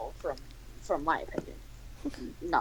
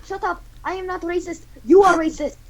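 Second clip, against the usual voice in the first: high-pitched put-on voice; mock-indignant high voice; squabbling character; 8 semitones higher than usual.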